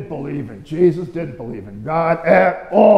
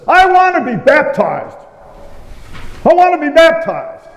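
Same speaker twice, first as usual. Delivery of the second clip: eager shout; 11 semitones higher, 6 dB louder than usual. Retold or imitated. Man jumping up at the back of church, loud high shout. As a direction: call out like a man desperate to be baptized. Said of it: "and he says".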